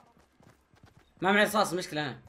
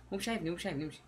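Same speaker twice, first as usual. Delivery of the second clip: very soft, secretive murmur; stealthy hush; 4 semitones lower, 13 dB quieter, much faster than usual. Real, not acted. Player sneaks past unaware enemy, hushed murmur. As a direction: murmur it so the enemy won't notice.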